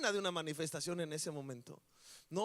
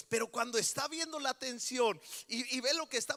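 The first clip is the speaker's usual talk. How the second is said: higher, louder sermon voice telling the story; full-force preaching; 8 semitones higher, 4 dB louder than usual. Acted. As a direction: preach it out with fire. Preaching rising to climax.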